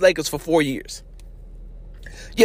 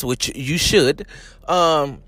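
ordinary delivery

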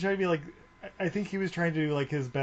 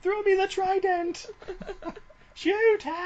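much higher and louder, comic warrior shouting; hammed-up battle cries; 5 dB louder than usual. Riffing on the movie with friends, high hammed-up shouted commands. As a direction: bark orders like a cartoon gladiator.

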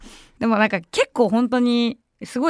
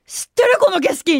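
much higher, thrilled "I know this view!"; excited discovery; 9 semitones higher than usual. Retold or imitated. She excitedly tells coworkers she remembers the bridge. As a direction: burst out in delighted recognition.

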